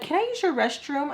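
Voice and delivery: polite asking. Retold or imitated. Acted polite request in dream.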